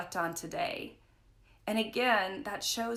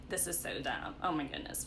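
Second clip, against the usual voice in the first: put-on teen grumble; 6 semitones lower, 7 dB quieter than usual. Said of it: "I'm just like".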